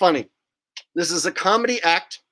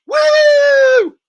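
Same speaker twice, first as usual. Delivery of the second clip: much higher, loud triumphant whoop; loud woo cheer; 12 dB louder than usual. Pretend. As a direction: let out a big victory whoop.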